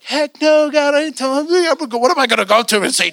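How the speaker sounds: mock indignant refusal. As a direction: balk loudly at an absurd order. Acts out refusing God's order.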